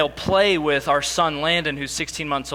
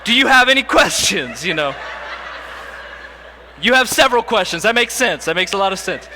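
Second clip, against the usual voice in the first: louder, higher, laughing through a pretend Q and A; dad imagining fielding the child's questions; mock patient parent; 3 semitones higher, 5 dB louder than usual. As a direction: play the patient dad, barely holding a laugh. Imagined questions after talk.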